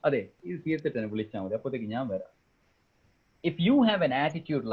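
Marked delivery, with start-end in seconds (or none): 0.1-2.3 low, soft, hands-off reply; offhand fresher. Acted. Fresher telling colleagues to call once it is finished, offhand. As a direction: play the fresher leaving it to others, voice lowered. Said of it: "the fresher".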